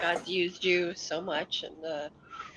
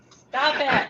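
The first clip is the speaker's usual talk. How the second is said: laughing stop it; 6 semitones higher, 11 dB louder than usual. Real, not acted. Husband making faces at her.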